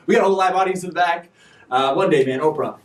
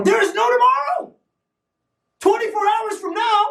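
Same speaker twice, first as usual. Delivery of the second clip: emphatic shout; 14 semitones higher than usual. Real, not acted. Host tells viewers there is no tomorrow, shouting high. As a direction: shout it like a wake-up call. Rebuts procrastinators' excuse.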